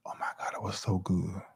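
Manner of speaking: breathy hushed whisper of pleasure; whispered delight. Real, not acted. Telling viewers about ribs, whispered savoring. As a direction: whisper it like a secret treat.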